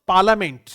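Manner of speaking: पार्लियामेंट said as "paləmẽɳʈ", loud and clear; correct pronunciation. Shown as a demonstration. Teacher models the word for the class, loud and clear. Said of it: "सही phonetic transcription होता है।".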